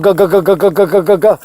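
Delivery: rapid, rhythmic thumping noise; imitated pounding heartbeat. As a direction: thump out a racing heart in repeated beats. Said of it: "my heart was going".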